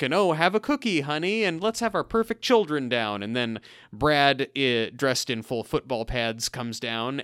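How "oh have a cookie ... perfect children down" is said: high, lilting mock sitcom housewife; over-the-top fifties acting. Fifties parents in a home movie, hammy wholesome cheer. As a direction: imitate a too-perfect fifties TV parent. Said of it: "really over-the-top kind of Leave It to Beaver fifties acting".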